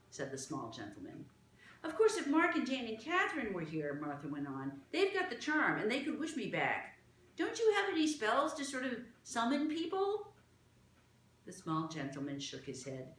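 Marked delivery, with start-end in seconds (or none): 1.8-10.3 put-on higher, eager girl's plea; a child hoping for a spell; hopeful Martha. Martha asks the gentleman for spells, hopeful.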